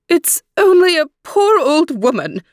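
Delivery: high, squeaky crone voice; squeaky old woman voice. Acted. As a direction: squeak like a frail old peddler. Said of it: "came a squeaky voice".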